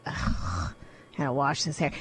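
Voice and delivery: low, soft, slow groaning dread; reluctant groan. Retold or imitated. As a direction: drag it out like the chore weighs a ton. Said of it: "I say".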